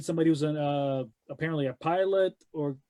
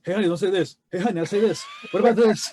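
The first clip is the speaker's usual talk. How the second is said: louder, raised voice calling from the back; heckling partner; 4 semitones higher, 6 dB louder, faster than usual. Pretend. Imagining the partner calling advice from the back, mock heckling. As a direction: imitate a partner butting in, building louder. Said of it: "in the back going like".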